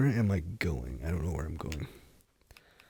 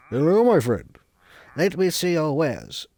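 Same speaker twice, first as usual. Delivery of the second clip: higher, louder put-on trader voice; mock merchant greeting; 6 semitones higher, 5 dB louder than usual. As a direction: greet the shopkeeper like a quirky trader. Role-plays a villager trader.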